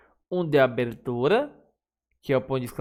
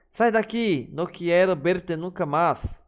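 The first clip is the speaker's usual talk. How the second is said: angry exclamation; 4 semitones higher than usual. Shown as a demonstration. Teacher demonstrating anger in an exclamation, hammed-up rage. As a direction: throw them out in a staged fury.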